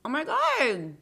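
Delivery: high, loud burst of excitement; excited. Real, not acted. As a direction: squeal it out. Excited exclamation.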